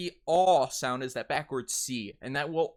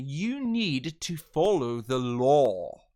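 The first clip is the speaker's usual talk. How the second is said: slow, loud, exaggerated British English; drawn-out British accent; 7 dB louder, much slower than usual. Shown as a demonstration. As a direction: stretch it out in fancy British. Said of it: "kinda draw it out a little bit".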